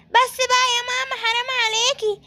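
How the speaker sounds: put-on high, whining child's plea; whiny protest. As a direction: beg mom to stop in a high whine. Child protests being dragged to bath.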